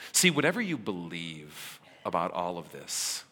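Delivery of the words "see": ordinary talk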